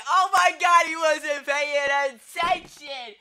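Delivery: sudden yelling play-by-play, high and loud; excited commentator shout. Pretend. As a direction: shout it like a sports caster caught off guard.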